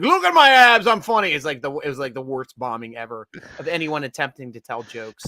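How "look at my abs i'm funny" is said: high, loud mock Jersey boast; The Situation. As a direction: mimic a comic bombing at a roast. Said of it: "That was like his roast".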